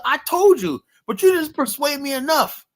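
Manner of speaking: high, loud retort; mock retort. Answering the friend who blamed him, in a high mock retort. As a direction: toss it back at her, mockingly high.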